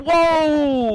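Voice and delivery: "Whoa," held long, high and sliding down; elongated word. Shown as a demonstration. Streamer admitting to stretching words, elongated "Whoa,". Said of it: "Maybe I'll elongate it".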